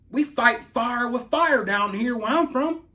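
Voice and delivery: slow, drawn-out Southern country accent; a mock-tough Southern country boast; put-on country accent. Shown as a demonstration. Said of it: "I could put on my country accent".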